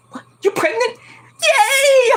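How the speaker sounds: high, squealing cheer; mock joyful surprise. Host mimics Corey thrilled at the pregnancy news, squealing high. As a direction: ham up the overjoyed husband. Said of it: "Corey's like".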